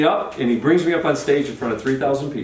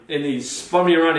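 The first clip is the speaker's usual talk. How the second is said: usual speaking voice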